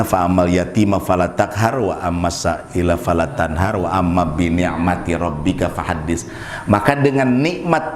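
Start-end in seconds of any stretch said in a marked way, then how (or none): 0.0-6.3 Quranic recitation. Acted. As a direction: recite the verses gently, as in prayer. Recites the surah verses.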